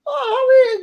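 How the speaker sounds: squeaky high mock "Oh"; mock squeal. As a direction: squeal it, playing her offended. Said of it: "She's like".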